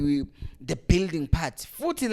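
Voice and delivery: ordinary delivery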